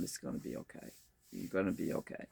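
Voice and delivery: very soft, low, hushed reassurance; tender, near-whispered consoling; soothing sister. Retold or imitated. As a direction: murmur gentle comfort while rubbing someone's back. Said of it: "she's just rubbing my back and going".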